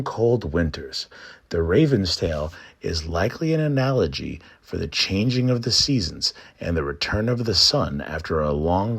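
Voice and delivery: ordinary delivery, nothing marked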